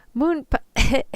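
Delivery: calling the name out loud, cut short; too loud. Acted. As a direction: call his name out loud, too loud for the room. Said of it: "can't talk that loud".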